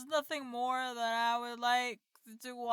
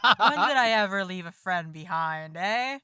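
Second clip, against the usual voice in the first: bold loyal declaration; 3 semitones lower, 7 dB louder than usual. Acted.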